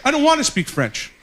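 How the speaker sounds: normal talking voice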